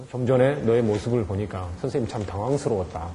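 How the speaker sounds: soft, honest confession of feeling; sincere and calm. Shown as a demonstration. Teacher later tells the child honestly how she felt, soft. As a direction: share your feeling gently. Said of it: "솔직히 자신의 마음을 표현하는".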